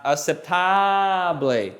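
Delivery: very slow "aceptable", long open "ah"; big-mouthed A. Shown as a demonstration. Teacher showing the A, "aceptable" with long dentist "ah". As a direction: stretch the "ta" of "aceptable" into a wide "tah". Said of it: "with a big mouth".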